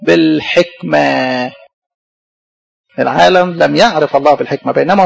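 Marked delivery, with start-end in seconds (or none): 0.0-1.5 drawn-out answer. Real, not acted. Congregation-style answer, drawn out.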